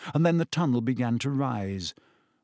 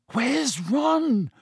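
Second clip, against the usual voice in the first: high, frightened character voice; terrified; 12 semitones higher than usual. A narrator's reading. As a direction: pitch it way up in alarm, not truly whispered. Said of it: "whispered in a terrified voice".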